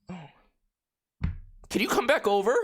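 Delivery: high, very slow mimicked request; pause, then ask it slowly and high; imitated girl's plea. Girl asks him back after screaming, drawn-out plea.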